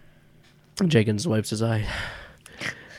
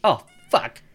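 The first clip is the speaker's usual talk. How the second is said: Jacob's curse; 4 semitones higher, 6 dB louder than usual. Acted. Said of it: "And Jacob's like".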